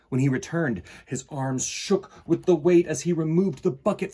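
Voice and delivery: put-on storybook narration for kids; reading to children. A narrator's reading. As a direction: read the story aloud to the class. Reads picture book to children.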